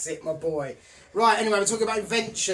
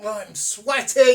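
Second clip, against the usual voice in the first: loud complaint; 3 semitones higher, 10 dB louder, slower than usual. Real, not acted. Complaining about the heat.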